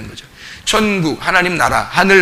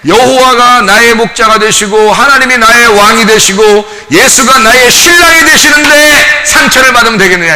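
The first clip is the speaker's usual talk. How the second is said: preaching climax; 5 semitones higher, 7 dB louder than usual. Acted. Sermon climax, rising to proclaim.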